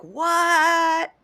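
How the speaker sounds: high, drawn-out mock-astonished cry; imitated shock. Everybody reacting to the surprise guest, imitated shock. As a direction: stretch it out high in exaggerated disbelief.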